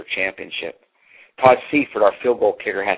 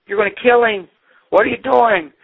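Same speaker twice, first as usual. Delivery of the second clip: much higher, alarmed mock scream of the crowd; panicked screaming; 12 semitones higher than usual. Crowd screams at the high-wire man in alarm. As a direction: cry out in horror as the boy is taken. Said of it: "and they're screaming".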